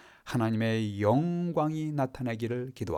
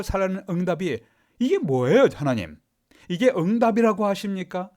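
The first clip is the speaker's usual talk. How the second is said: rising, louder indignant protest; resentful complaint to God; 5 semitones higher, 3 dB louder than usual. Retold or imitated. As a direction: climb to an exasperated cry on 이게 뭐예요. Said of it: "바울은 이렇게 원망하지 않았습니다". (원망하며 따지는 격앙된 목소리)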